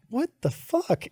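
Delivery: high, hushed disbelief; baffled disbelief. Retold or imitated. Arriving to find the interview restaurant closed, baffled. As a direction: retell a baffled reaction, softly and high. Retelling own baffled reaction.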